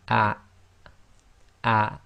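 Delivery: "ah" cut off by a glottal stop; Arabic hamza with sukun. Shown as a demonstration. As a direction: block the bottom of the throat at the end of "ah". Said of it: "hamza acts as the glottal stop".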